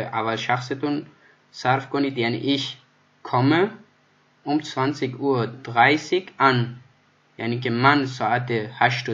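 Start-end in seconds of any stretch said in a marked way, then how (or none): 2.6-6.6 each word slow and spaced out; slow model reading. Shown as a demonstration. Teacher to Persian learners, slow, spaced-out German model sentence. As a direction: read it out slowly so students can follow. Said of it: "teacher models sentence slowly".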